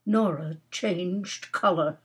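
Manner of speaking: plain reading voice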